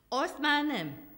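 angry thunder. A narrator's reading. The Lord angrily refuses the angel's plea, thundering. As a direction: slam the door on the request. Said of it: "dörgött rá most először mérgesen".

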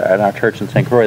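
ordinary talk

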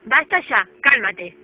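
loud firm telling-off; stern scolding. Real, not acted. Owner loudly scolding her screaming parrot. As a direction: clamp down on a screeching bird.

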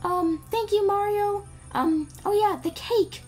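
put-on high princess voice; Peach. Princess thanks Mario for saving her, put-on high voice. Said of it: "Peach is like".

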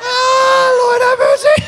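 very high, loud squeal; laughing exclamation. Real, not acted. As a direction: cry out, overcome with laughter. Exclaims amid long laughing fit.

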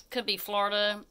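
ordinary delivery

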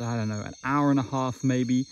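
usual speaking voice

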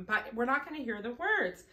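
normal talking voice

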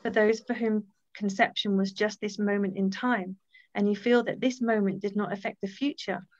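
reading a message from Rosa aloud to the group; inspirational reading. Acted. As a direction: read it like a calm blessing. Reads a page from Rosa's Choice.

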